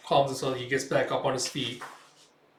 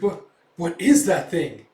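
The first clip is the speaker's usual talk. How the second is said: higher, louder, drawn-out bewildered question; startled alarm; 4 semitones higher, 8 dB louder, slower than usual. Retold or imitated. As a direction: stammer it in slow disbelief. Character frightened by the creature.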